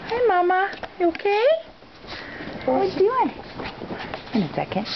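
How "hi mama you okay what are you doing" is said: high pet voice. Real, not acted.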